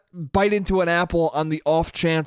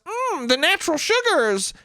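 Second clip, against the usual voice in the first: high, quick, smug nerd voice; prissy know-it-all kid; 12 semitones higher than usual. Acted. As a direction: sound like a little know-it-all correcting grown-ups. Voicing Steven savoring apple.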